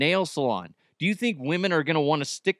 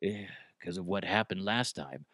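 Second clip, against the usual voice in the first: much softer, lower, haunted mumble; mock traumatized mumble; 6 semitones lower, 8 dB quieter than usual. Host voices spooked co-host, softer and lower haunted mumble. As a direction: mumble it like he's still shaken.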